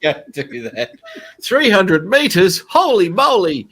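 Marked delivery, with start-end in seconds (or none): 1.4-3.7 louder, higher, slow amazement; excited. Real, not acted. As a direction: marvel at the distance. Amazed at range test result.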